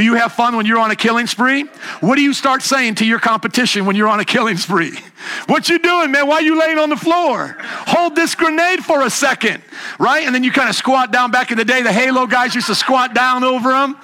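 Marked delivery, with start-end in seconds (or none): 5.5-9.6 high-pitched gamer trash talk; mocking taunt. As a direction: taunt a beaten opponent from on high. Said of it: "You mock 'em. You scoff 'em".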